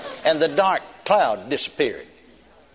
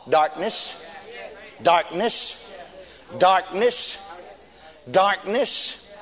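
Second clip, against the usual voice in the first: raised, drawn-out scripture proclamation; impassioned preaching; 4 semitones higher, slower than usual. Real, not acted. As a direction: preach it phrase by phrase, with long pauses.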